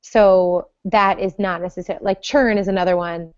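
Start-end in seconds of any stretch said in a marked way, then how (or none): none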